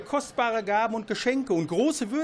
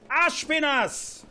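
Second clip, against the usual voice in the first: higher, slow, stretched shout; long drawn-out call; 9 semitones higher, slower than usual. Acted. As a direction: drag the name out, impatient. King calls again, drawn out. (gedehnter, langer Ruf)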